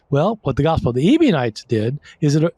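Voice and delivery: ordinary talk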